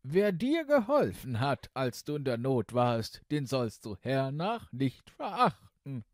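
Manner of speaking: mildly angry royal rebuke; angry king. Acted. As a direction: scold the daughter with royal anger. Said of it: "Der König aber war zornig".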